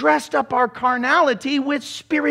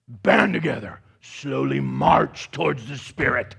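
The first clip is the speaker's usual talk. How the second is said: spiritual nut; 8 semitones lower than usual. Acted. Preacher making fun of church zealots advancing on a spirit, low and solemn. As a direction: drop low and march the words out. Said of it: "ever met a spiritual nut like that".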